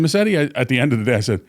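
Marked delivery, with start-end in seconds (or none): 0.0-0.4 calling her name. Retold or imitated.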